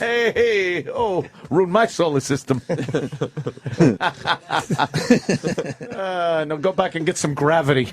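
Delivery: high, laughing mock Italian; jolly italian pizza chef. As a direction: giggle through a comic Italian voice. Italian bit continues with laughter.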